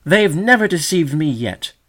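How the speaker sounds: loud, high, firm declaration; firm indignant cry. A narrator's reading. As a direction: stand firm with ruffled indignation. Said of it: "cried the young sedge warbler firmly".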